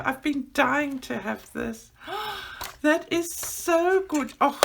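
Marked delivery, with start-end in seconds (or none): 2.1-2.8 sharp, soft intake of breath in delight; a quick thrilled gasp. Real, not acted. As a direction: gasp at the sight of the gift. Opening a long-wanted gift.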